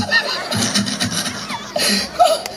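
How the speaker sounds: a high airy burst of laughter; high breathy laugh. Real, not acted. Preacher laughing at his beating story, a high airy laugh. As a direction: laugh off the painful memory.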